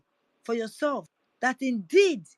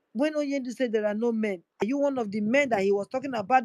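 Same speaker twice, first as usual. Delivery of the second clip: ordinary delivery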